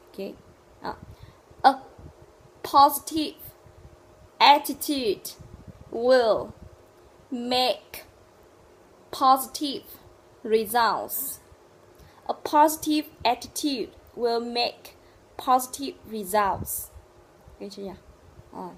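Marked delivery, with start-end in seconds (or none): 1.6-11.4 loud, isolated words with a long pause after each. Shown as a demonstration. Teacher drilling a sentence for learners, word by word. 12.3-16.9 slow, loud model sentence in joined phrases; connected sentence. Shown as a demonstration. Teacher modelling the full sentence, connected and deliberate.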